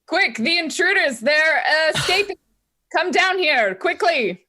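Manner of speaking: high, loud faked cry; calling for help. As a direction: shout up the stairs for help. [name] covers mouth, calls out.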